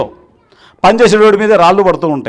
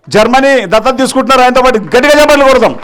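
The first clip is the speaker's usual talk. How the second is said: high, raised emcee voice; rousing call to the crowd; rallying announcement; 5 semitones higher, 4 dB louder than usual. Real, not acted. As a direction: proclaim it and call for big applause. Announcing volunteer, calling for applause.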